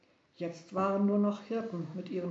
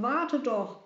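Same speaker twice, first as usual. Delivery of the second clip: calling after; 5 semitones higher, 5 dB louder than usual. Acted. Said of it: "riefen die beiden".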